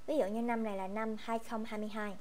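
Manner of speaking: usual speaking voice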